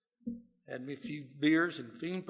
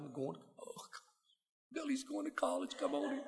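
high, thin mock voice of a proud father, much higher; imitating proud daddy; 10 semitones higher, 12 dB quieter than usual. Father greeting his sunburnt son at the car, high mock brag. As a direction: ham up a father bragging about his son.

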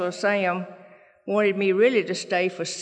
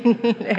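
short laugh; 4 semitones higher, 6 dB louder than usual. Real, not acted. Laughing after husband's quote.

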